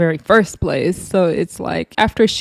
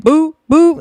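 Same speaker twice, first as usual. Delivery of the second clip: mock boo; 9 semitones higher, 5 dB louder than usual.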